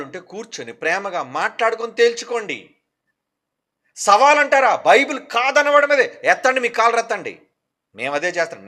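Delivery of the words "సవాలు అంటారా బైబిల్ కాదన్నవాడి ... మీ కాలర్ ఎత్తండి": loud and high, rallying cry; fiery preaching. Real, not acted. Preacher urging his followers to raise their collars, loud and fired up. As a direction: rouse the crowd, full voice.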